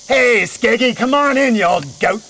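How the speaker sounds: put-on old witch calling her goat into the house. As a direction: holler for the goat to come in. Berchta calls her goat inside.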